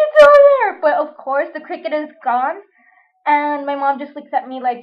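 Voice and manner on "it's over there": high, loud frightened yell; panicked shout. Retold or imitated. Pointing mom to the cricket, shrieking high and loud.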